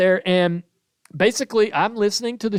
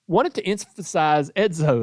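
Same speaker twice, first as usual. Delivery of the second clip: normal talking voice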